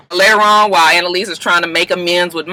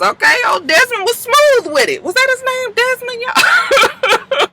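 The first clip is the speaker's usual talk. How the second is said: laughing. Real, not acted. Giggling about smooth Desmond.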